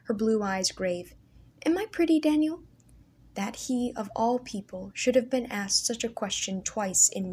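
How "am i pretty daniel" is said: put-on higher, girlish sister asking shyly; a young girl hoping to be told she is pretty; lightly raised girlish voice; voice of young sister Leah. A narrator's reading.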